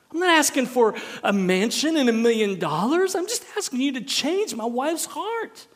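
loud, high, desperate demand; exasperated cry for an answer; anguished prayer. Acted. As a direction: plead with God at near-shouting pitch. Acts out questioning God in prayer.

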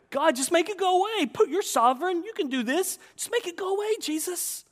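high, loud mock plea; pity-party pleading. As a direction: whine to God in self-pity. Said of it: "you have a pity party".

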